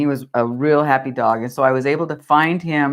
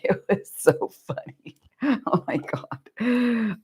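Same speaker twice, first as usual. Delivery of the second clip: words breaking through real laughter, softer; laughing; 6 semitones higher, 6 dB quieter, slower than usual. Real, not acted. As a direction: laugh helplessly at the memory. Laughing at retold story.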